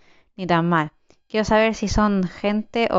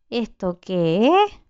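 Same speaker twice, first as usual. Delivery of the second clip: slow, drawn-out disbelieving question; slow bewildered repeat; slow puzzled question; much slower than usual. Real, not acted. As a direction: stretch out each word in disbelief.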